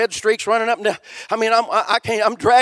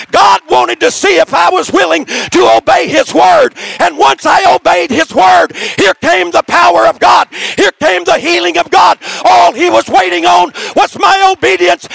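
loud, higher fervent preaching; full-force preaching; 6 semitones higher, 7 dB louder than usual. Acted. Preacher exhorting the congregation about obedience, shouting. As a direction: drive the message home at a shout.

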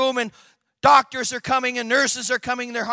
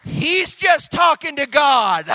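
slow, loud, higher proclamation; shouted punchline; 5 semitones higher, 5 dB louder, slower than usual. Retold or imitated. Ambulance workers explaining patient to doctors, slow triumphant shout. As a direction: proclaim it slowly to the whole room.

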